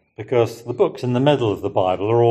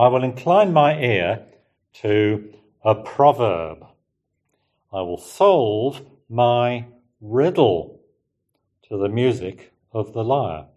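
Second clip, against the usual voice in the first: slow, measured reading of the verse; scripture reading. Acted. Preacher reads the psalm verse to listeners, slow and measured. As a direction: read the psalm aloud deliberately, as to a congregation.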